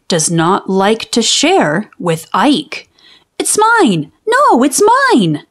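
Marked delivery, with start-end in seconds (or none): 3.4-5.5 put-on high, childish "mine" squabble; squabbling kid. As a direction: play a small boy grabbing his toy back. Twins squabbling over a toy.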